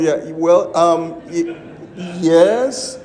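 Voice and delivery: hesitant, halting yes; reluctant. Retold or imitated. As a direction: drag out a reluctant admission.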